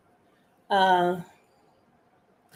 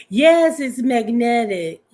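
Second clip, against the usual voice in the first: usual speaking voice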